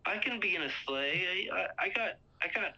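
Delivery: mock-flirty friend showing himself off; eager friend. Friend pitching himself to women.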